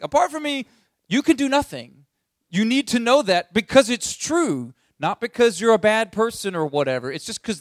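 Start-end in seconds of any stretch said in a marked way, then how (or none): 0.0-2.0 high, loud Jesus voice, a touch theatrical; Jesus, emphatic. Acted. Preacher voicing Jesus giving them the business, high and forceful. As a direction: play Jesus laying it down flat. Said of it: "he's like".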